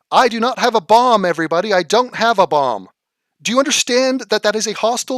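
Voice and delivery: yelling at chat about a bomb joke; exasperated yelling. Real, not acted. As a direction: shout in disbelief at their stupidity.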